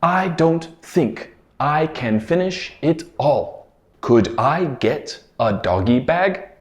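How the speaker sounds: slow, careful "I don't think I can finish it all"; slow clear model. Shown as a demonstration. Teacher slowly modelling the phrase for students. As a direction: spell it out slowly for learners. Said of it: "This phrase was".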